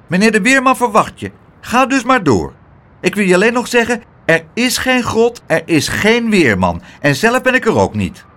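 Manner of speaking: high, emphatic little gatekeeper voice; pint-sized gatekeeper laying down denials; nervy, contrary little doorman. A narrator's reading. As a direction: insist officiously in a high small voice.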